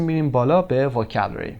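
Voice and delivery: normal talking voice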